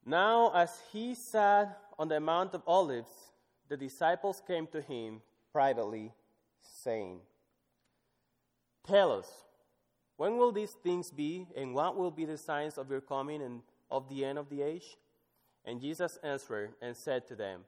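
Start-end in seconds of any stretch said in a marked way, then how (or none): 0.0-7.2 scripture reading. Acted. Reads scripture aloud. 8.8-15.0 raised, emphatic reading of the disciples' question; scripture reading. Acted. Reads scripture aloud.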